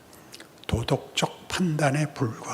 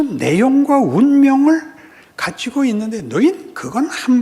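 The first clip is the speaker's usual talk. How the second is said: loud, rising rebuke as God, higher and louder; God scolding; 10 semitones higher, 9 dB louder than usual. Acted. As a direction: scold the people like an offended father. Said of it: "하나님이 꾸짖습니다".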